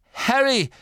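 high, pressed, urgent voice; urgent report. A narrator's reading. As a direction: get the warning out to a friend mid-battle. Injured friend gives urgent report.

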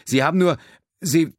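high, faltering broken-off words; stammering in shock. A narrator's reading. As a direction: stumble over the words, aghast. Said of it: "stammelte er".

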